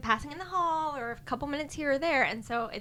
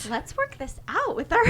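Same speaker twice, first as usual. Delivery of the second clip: diplomatic teacher voice; 7 semitones higher, 3 dB louder than usual. Retold or imitated. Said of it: "we're being diplomatic".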